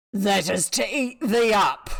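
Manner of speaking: spring the trap with relish; wolf's threat. Acted.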